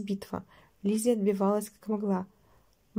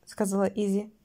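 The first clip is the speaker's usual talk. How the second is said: ordinary delivery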